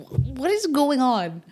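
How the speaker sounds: sudden disbelief at the ads; baffled outburst. Real, not acted. Baffled by back-to-school ads.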